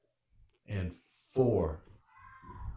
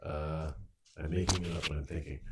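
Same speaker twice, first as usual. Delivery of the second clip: normal talking voice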